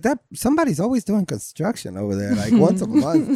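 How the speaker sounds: nosy neighbor. Retold or imitated. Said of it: "the neighbors be like".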